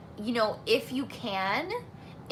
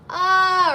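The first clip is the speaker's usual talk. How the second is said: high, loud open "Ah" cry; demo high scream; 9 semitones higher, 11 dB louder than usual. Shown as a demonstration. Vocal coach demonstrating the bad high scream. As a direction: belt a high unsupported "Ah". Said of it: "instead of a really high".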